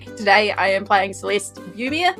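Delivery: ordinary delivery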